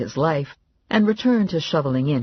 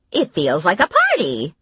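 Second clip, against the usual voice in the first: Grandma voice; 4 semitones higher, 5 dB louder than usual. A narrator's reading. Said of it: "Grandma said".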